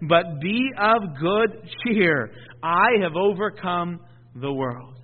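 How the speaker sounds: slow, weighty reading; slow scripture quote. Acted. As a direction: lean on each word slowly.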